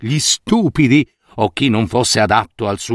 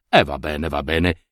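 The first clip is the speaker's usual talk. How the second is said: low, soft, sighing resignation; resigned minister; 11 semitones lower, 5 dB quieter than usual. A narrator's reading. Old minister resigning himself before the empty loom, low and soft. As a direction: give in with a weary shrug.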